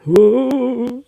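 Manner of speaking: high, loud spooky ghost moan; a mock ghost wailing ooh; ghostly ooh.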